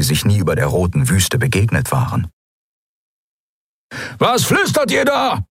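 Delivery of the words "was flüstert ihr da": high, barking pirate shout; shrill drugged-up pirate shout; angry pirate yell. A narrator's reading. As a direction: snap at the prisoners. Halbhorn yelling.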